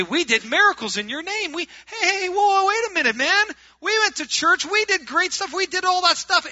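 high, loud pleading of the rejected; frantic protest. Acted. Churchgoers pleading their case before the Lord on judgment day, frantic protest. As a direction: protest loudly, pitch shooting way up.

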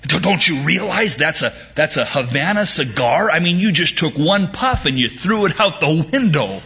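horrified American. Retold or imitated. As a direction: voice the shocked businessman. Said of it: "looked horrified".

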